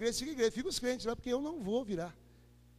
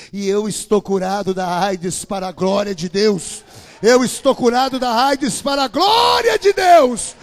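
slow, loud proclamation; full-force pulpit cry; triumphant preaching; 18 dB louder, slower than usual. Real, not acted. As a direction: shout the healing to the crowd. Shouted proclamation of healing.

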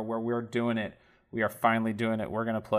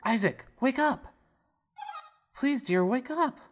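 high voiced mum, much higher; sing-song wake-up call, pitched high; mother waking son. Mother gently waking Isaac, high voice. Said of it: "It says".

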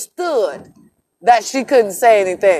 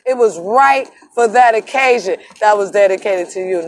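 ordinary delivery

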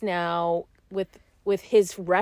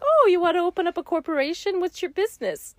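higher-pitched, lightly acted customer chat; banker's question; 10 semitones higher than usual. Banker asking two walk-in clients, lightly acted polite question. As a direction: put on a chirpy-high polite inquiry.

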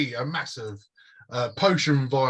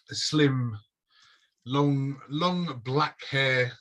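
ordinary delivery

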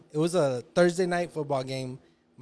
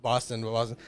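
ordinary talk

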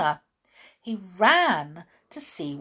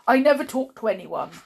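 loud, higher-pitched rude rebuff; 7 semitones higher, 11 dB louder than usual. A narrator's reading. Blind man refusing a stranger's company, loud and rude. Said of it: "said the blind man rudely".

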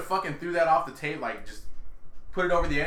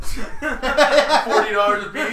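slow, higher, laughing through the words; laughing disbelief; 9 semitones higher, 5 dB louder, much slower than usual. Real, not acted.

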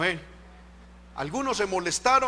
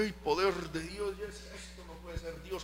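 very soft, under the breath; muttered private dismay; 11 dB quieter than usual. Retold or imitated. Preacher retells his doubt during her shouting, muttered very quietly. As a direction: mumble it to yourself in disbelief.

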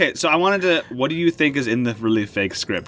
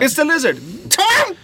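high, loud comic outburst; punchy dramatic comic narration; 13 semitones higher, 3 dB louder than usual. Pretend. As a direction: blurt it out then yell his name. Mock Tom spoiler scene.

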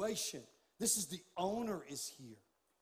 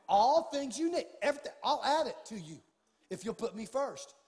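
preaching at full force; 6 semitones higher, 7 dB louder than usual. Acted. Pastor urging his church to go all in, loud preaching. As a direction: build it to a shouted climax.

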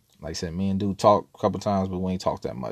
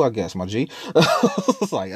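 laughing; 4 semitones higher, slower than usual. Real, not acted. Laughing through his amazement.

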